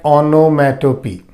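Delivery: "onomatopoeia" said carefully in parts, on-no-mat-o-pee; measured teacher's model of "onomatopoeia"; demonstrated pronunciation. Shown as a demonstration. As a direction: show how "onomatopoeia" is pronounced, ending on a falling "pee". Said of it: "we pronounce it like".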